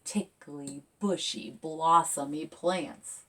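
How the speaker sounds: ordinary delivery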